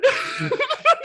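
real high-pitched laugh. Real, not acted. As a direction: crack up at your own impression. Cracks up at own imitation.

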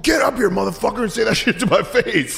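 high-pitched mock bar-fight challenge; Roadhouse tough guy. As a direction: call him out like a movie bouncer. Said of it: "I turned into a guy from Roadhouse".

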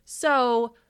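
usual speaking voice

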